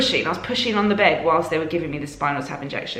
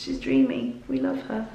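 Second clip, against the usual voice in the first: soft, higher-pitched doting murmur; tender adoration; 7 semitones higher, 4 dB quieter than usual. Real, not acted. Mother doting on her baby, soft high tender adoration.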